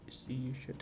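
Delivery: soft, dropped repeat; quiet aside. Real, not acted. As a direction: say it softly under your breath. Soft repeat after eager call.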